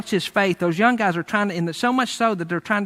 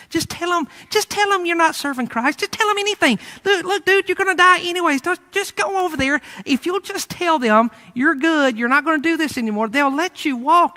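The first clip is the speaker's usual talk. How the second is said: high-pitched, wheedling plea; urgent coaxing; 10 semitones higher than usual. Acted. Soldiers urging an old prisoner to give in, high-pitched coaxing. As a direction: coax him in a raised, insistent voice.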